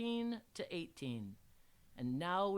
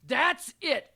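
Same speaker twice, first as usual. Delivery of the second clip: high, loud exasperated bellow; 9 semitones higher, 12 dB louder than usual. A narrator's reading. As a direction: bellow like a fed-up teacher. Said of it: "I bellowed".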